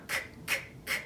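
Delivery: "k" as a voiceless, soft click of breath; clicking camera sound. Shown as a demonstration. Teacher modelling the camera sound, "k" as a bare voiceless click. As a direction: say "k" with no vowel, just the consonant. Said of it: "The clicking camera sound".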